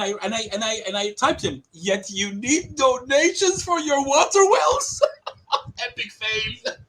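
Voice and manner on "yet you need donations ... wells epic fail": high, slow jeer breaking into laughter; laughing through a mocking comeback. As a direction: retell the taunt while cracking up. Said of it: "I died laughing".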